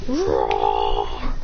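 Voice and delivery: low, rumbling acted roar; creature roar. Creature roars.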